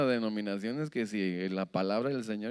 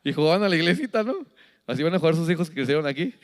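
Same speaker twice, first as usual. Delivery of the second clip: ordinary talk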